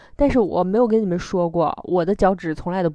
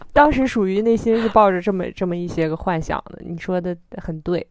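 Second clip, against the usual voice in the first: normal talking voice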